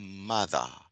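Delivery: "Mother" with a voiced th. Shown as a demonstration. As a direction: buzz the th in "Mother". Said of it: "esse daqui também".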